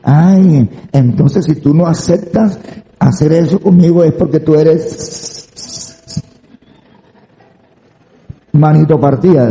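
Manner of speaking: imitación ligera de una muchacha, algo más aguda; muchacha provocadora. Retold or imitated. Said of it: "Y eso me ha dicho". (muchacha que provoca, imitada)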